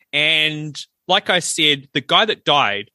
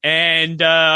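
ordinary talk, nothing marked

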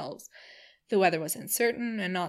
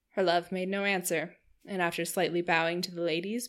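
plain reading voice

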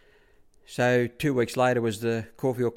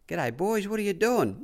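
high-pitched imitation of a trainer; lilting, raised-pitch impression of the boss; Bart; 10 semitones higher than usual. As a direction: greet them in a put-on higher voice. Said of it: "and Bart pulls up. He said".

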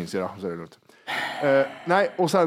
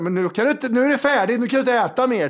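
higher-pitched, exasperated mock protest; exasperated inner voice; 8 semitones higher than usual. Train passenger silently protesting the neighbour's chewing, exasperated. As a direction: act out your fed-up thought. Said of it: "tänkte jag så här".

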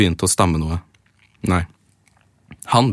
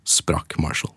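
slow hushed whisper, building suspense; dramatic whisper; 3 semitones lower, 6 dB quieter, much slower than usual. Acted. Reading fan fiction aloud to podcast friends, slow dramatic whisper. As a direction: breathe it out slowly like a cliffhanger.

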